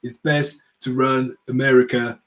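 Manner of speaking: slow, drawn-out mock Trump accent; hammed-up Trump accent read at half speed; Trump impression. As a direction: play Trump selling a rigged question, slowly. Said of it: "this is my Trump accent".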